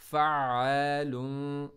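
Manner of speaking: फ़अलुन slow, ain unbroken, लुन plain; flowing ain. Shown as a demonstration. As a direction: let फ़अलुन flow without a stop. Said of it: "लुन को सिं-, simple आपने पढ़ना है". (फ़अलुन में आइन पर बिना रुके)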